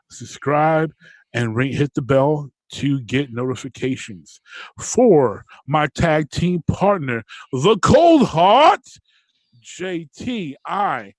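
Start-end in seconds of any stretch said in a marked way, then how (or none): 4.8-11.1 wrestling announcer. Acted. Wrestling-style sign-off introductions.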